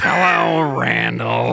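put-on, drawn-out character voice; Roz. Roz greeting Randall on the scare floor, put-on voice. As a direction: greet in a hammed-up character drone.

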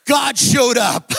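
slow, loud, ringing proclamation; triumphant shout. Real, not acted. Pastor shouts to the cheering congregation, slow and loud. Climax shout after applause.

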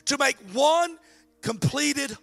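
slow, emphatic reading; slow weighty sermon reading; scripture reading. Acted. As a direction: let each phrase land slowly. Message translation read.

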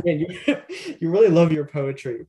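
laughing through the words while teasing; chuckling, ribbing a friend; amused teasing over his poetry mix-up; laughing tease. Real, not acted.

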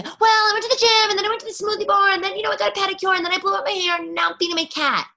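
high, fast gushing chatter; gushing girl. Retold or imitated. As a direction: rattle it off like an overexcited gusher. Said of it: "We're gushy text".

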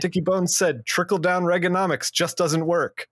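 high, punchy newsreel announcer; old-timey radio voice. Pretend. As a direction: read it like a thirties newsreel. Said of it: "Imagine it in old-timey radio voice".

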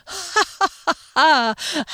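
high theatrical ha ha ha; head-back stagey laughter; hammed-up laugh. A narrator's reading. Phoenix laughs off Rickenbacker's worry, hammed-up laughter. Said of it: "threw his head back and laughed".

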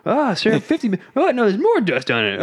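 high mock-exasperated voice; cartoon back-and-forth. Still reading the dusty title, high comic exasperation. Continues cookbook dust scene.

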